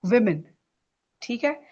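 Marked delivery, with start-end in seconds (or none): 0.1-0.4 women as VIM-in, falling; women, first vowel i, not oo; correct pronunciation. Shown as a demonstration. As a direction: model women with a short i first. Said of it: "it is women".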